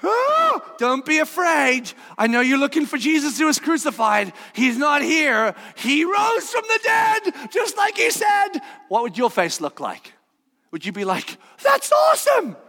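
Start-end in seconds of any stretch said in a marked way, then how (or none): 0.0-8.5 gentle angel voice. Acted. 11.6-12.6 squeaky high sarcastic cheer; mock excited. Mock reaction of witnesses.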